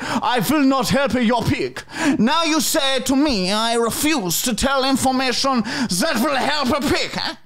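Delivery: exaggerated foreign prank caller, high-pitched; Arnold Fine voice. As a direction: ham up an indignant pig farmer. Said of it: "You sounded like a villain from James Bond".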